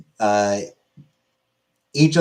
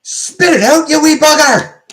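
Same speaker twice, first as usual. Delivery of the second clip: much higher, much louder Scottish yell; Scottish shout; 9 dB louder than usual. Retold or imitated. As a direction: bellow it like a rowdy Scotsman in a pub. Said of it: "Scotland says".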